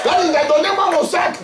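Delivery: shouting from afar. Retold or imitated. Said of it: "il criait parce que Kalinda était au loin".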